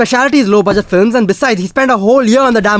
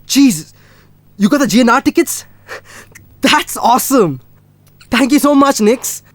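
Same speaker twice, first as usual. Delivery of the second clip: higher, delighted thanks; thrilled surprise; 6 semitones higher, 3 dB louder than usual. Acted. Boyfriend getting birthday concert tickets, thrilled. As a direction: gush over an unexpected gift.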